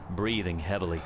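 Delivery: plain reading voice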